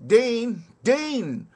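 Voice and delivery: high, loud cry under fire; calling out. Acted. Soldier calling the water boy under fire, loud high cry. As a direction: shout it over the bullets.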